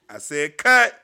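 loud director's shout; calling cut. Real, not acted. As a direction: shout it to stop the scene. Calling an end to the skit.

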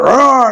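imitated haunted-house monster roar; quick high-pitched roar meant to scare; mock roar. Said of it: "they be like".

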